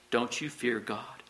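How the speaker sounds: slow, hushed rebuke; rebuking. A narrator's reading. One criminal to the other, quietly rebuking. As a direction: reprove him softly and deliberately. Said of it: "the other criminal rebuked him".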